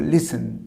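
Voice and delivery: "লিসেন" said lis-en, without t; silent t. Shown as a demonstration. Teacher repeating the correction, silent t. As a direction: go straight from s to en in "লিসেন". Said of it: "টিটা একদম silent থাকবে".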